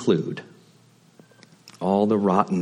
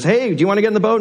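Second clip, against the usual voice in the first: much higher, louder friendly call; calling out from a speedboat; 13 semitones higher, 5 dB louder, much faster than usual. Retold or imitated. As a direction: shout an offer across the water.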